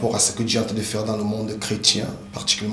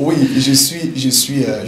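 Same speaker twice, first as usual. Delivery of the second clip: amused eager reply; 4 semitones higher, 9 dB louder than usual. Real, not acted. Guest laughing answer.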